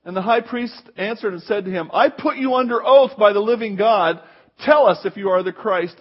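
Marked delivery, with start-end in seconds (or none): 1.9-6.0 loud, forceful command; commanding oath. Retold or imitated. High priest orders Jesus under oath, loud.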